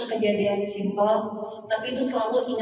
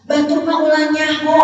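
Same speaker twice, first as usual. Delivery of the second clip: loud, higher mock mother's warning; 6 semitones higher, 10 dB louder than usual. Mother telling child others mustn't know, mock warning. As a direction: hiss it like a worried mother.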